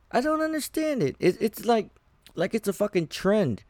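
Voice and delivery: high, exasperated disbelief; baffled high rant. Real, not acted. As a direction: throw up your hands, voice jumping high. Exasperated rant, much higher pitch.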